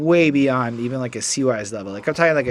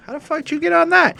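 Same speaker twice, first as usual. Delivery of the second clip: high, squeaky disbelief; incredulous high voice; 4 dB louder, faster than usual. Retold or imitated. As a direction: pitch it way up in shock. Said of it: "I was like".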